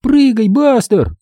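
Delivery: high, drawn-out order to a dog; girl's command. A narrator's reading. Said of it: "скомандовала Минди". (звонкая команда девочки)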